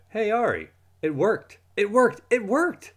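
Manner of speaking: high, loud triumphant cheer; excited bragging. Acted. Boy tricking his friend at school, excited cheer. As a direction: crow that the trick worked.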